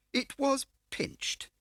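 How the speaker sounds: high, slow, unctuous fat man; smarmy dealer playing grand; oily fat car dealer. A narrator's reading. Scar the fat dealer answering Mendel, high slow unctuous voice. Scar's voice held.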